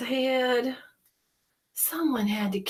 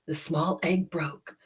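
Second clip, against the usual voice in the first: usual speaking voice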